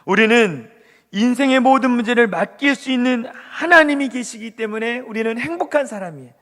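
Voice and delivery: normal talking voice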